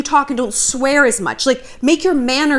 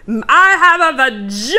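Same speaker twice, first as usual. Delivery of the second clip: louder, slow brash mock declaration; mocking Bella Thorne; 3 semitones higher, 4 dB louder than usual. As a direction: parody a brash over-sharer shouting it. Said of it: "who is just like".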